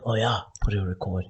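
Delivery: hushed offhand murmur; quiet, discreet. Shown as a demonstration. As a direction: say it like you're sneaking a recording. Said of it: "discreetly, silently".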